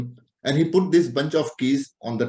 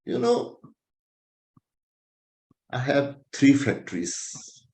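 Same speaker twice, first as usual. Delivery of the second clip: boasting patient; slower than usual. Retold or imitated. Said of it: "how a boasting patient sit in front of you".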